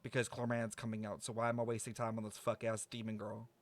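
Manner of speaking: usual speaking voice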